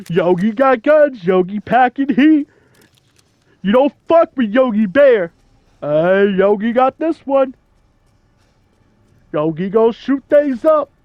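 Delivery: tough-guy Yogi impression, pushed loud; Yogi Bear. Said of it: "in a classic cartoon voice".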